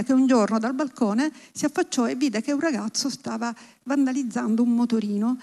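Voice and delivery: normal talking voice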